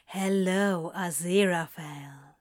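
low, whispered drawl of his name; Crowley drawling his name down the phone; slow seductive drawl. Acted. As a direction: draw the name out low and teasing. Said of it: "Crowley drawled".

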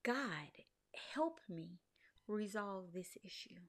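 hushed, breathy plea to God; whispered prayer. Retold or imitated. Praying to God over the broken website, whispered. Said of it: "I'm saying".

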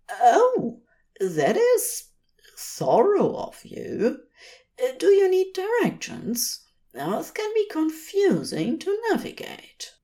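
a prim angel being overly helpful; Aziraphale, fussy angel. A narrator's reading. Aziraphale politely offering the stiff messenger directions.

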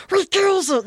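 high creepy hiss; Smeagol voice. Pretend. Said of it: "Smeagol just comes up like".

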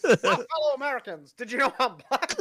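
high, hammed-up presidential address; Barack Obama. Co-host tries his president impression, hammed-up address. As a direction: pitch it up as a mock speech to the nation. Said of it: "it's a very good Barack Obama impression".

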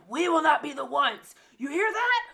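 high, loud, slow yell at the class; hammed-up classroom yelling; mock yelling at kids. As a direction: bellow it like a fed-up teacher. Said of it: "I'm always yelling at my kids".

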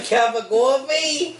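put-on slow, high Boston accent question. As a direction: drawl the Boston question slowly. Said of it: "the worst Boston accent".

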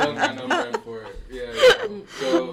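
high, loud words through laughter; cracking up at being broke; laughing. Real, not acted. Laughing at broke teenage shopping.

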